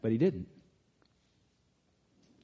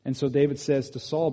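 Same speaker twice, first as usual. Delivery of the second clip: ordinary talk, nothing marked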